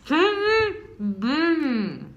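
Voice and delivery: slow and garbled, mouth packed; cheeks stuffed full. Real, not acted. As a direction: drag it out through stuffed cheeks.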